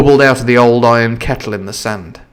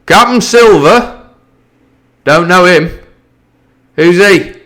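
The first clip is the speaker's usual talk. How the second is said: higher, louder scornful question; cried; 7 semitones higher, 5 dB louder than usual. Acted. Captain calling back to the mutineers, scornful cry. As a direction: call it back with disdain. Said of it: "cried the captain".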